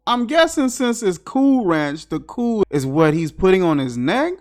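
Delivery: ordinary delivery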